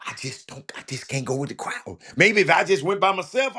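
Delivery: ordinary talk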